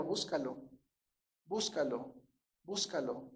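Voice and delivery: low, soft repeated command; hushed, low insistence, voicing the Lord; the Lord's quiet command. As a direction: drop the voice and space out each repetition. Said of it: "el Señor dejó bien en claro".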